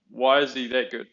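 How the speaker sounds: usual speaking voice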